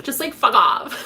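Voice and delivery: loud laughing dismissal. Shown as a demonstration. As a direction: blurt it out with a laugh. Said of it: "repeated after whisper".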